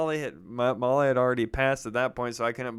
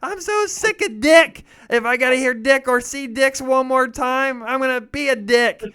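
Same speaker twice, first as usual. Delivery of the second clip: much higher, louder exasperated rant; fed-up mom; 13 semitones higher, 9 dB louder than usual. Retold or imitated. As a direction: let it all out like a mother who snapped. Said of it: "She goes".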